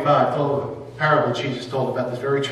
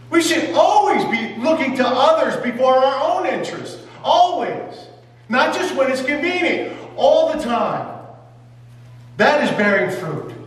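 full-force preaching; 8 semitones higher than usual. Acted. Preacher urging the church, shouting. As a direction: preach it hard to the congregation.

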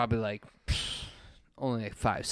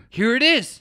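excited; 10 semitones higher, 7 dB louder than usual. Retold or imitated. Friend excitedly showing the movie.

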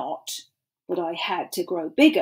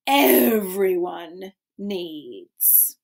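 slow drawn-out ending; 3 semitones lower, much slower than usual. Acted. Same line slowed.

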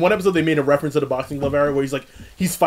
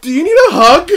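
mock caring; 12 semitones higher, 8 dB louder than usual. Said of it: "I'm like".

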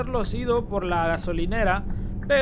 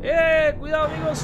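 higher, louder sudden warning; alarmed warning; 5 semitones higher, 5 dB louder than usual. Real, not acted. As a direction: warn the passengers in a hurry. Sudden hazard while driving. (advertencia alarmada, más aguda y fuerte)

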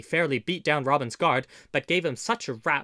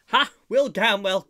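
high, loud cry of recognition; cried out; 8 semitones higher, 9 dB louder than usual. A narrator's reading. Robin recognising his nephew, crying out. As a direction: burst out with surprised recognition. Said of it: "cried Robin".

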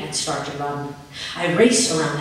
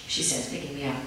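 ordinary delivery

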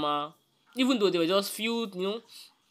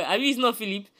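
usual speaking voice

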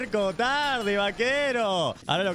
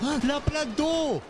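high excited cry; thrilled high shout; excited exclamation; 10 semitones higher, much slower than usual. Real, not acted. Excited reaction.

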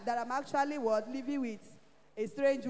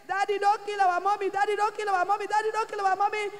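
put-on higher, louder pleading cry of frightened children; children shouting; 7 semitones higher, 4 dB louder than usual. Children begging their father to spare mommy, retold pleading shouts. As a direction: echo the kids' desperate cries.

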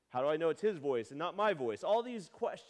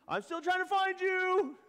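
dad calling out in hide and seek; 8 dB louder than usual. Retold or imitated. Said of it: "You're like".

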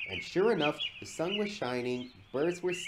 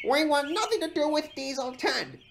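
high, oily character voice; oily, high-pitched protest; 4 dB louder than usual. A narrator's reading. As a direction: say it high and ingratiating. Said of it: "oiled Splatter".

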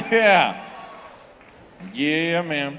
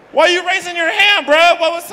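much higher and louder, calling out; incredulous shout; 9 semitones higher, 8 dB louder than usual. Real, not acted. Comedian shouting at a cheering audience member, incredulous. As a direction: yell at the guy in disbelief.

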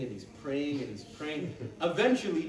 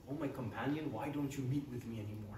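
gentle reproach; 3 semitones lower, 8 dB quieter, faster than usual. Acted. Prophet gently addresses ashamed companion.

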